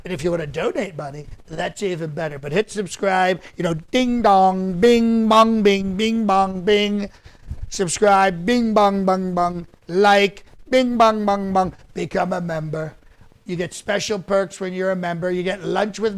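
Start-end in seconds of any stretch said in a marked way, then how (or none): 3.9-7.1 sing-song dinging like a doorbell; punchy spoken bell chimes; mock bell noises. Host imitating the notification bell for viewers, playful sing-song. 8.4-9.7 sing-song dinging like a doorbell; punchy spoken bell chimes; mock bell noises. Host imitating the notification bell for viewers, playful sing-song. 10.7-11.7 sing-song dinging like a doorbell; punchy spoken bell chimes; mock bell noises. Host imitating the notification bell for viewers, playful sing-song.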